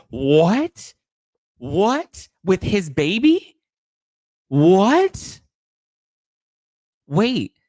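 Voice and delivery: high, loud shocked yelps; can't believe what chat just said; stunned disbelief. Real, not acted. Host hearing a pregnancy rumour, stunned disbelief.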